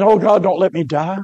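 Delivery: normal talking voice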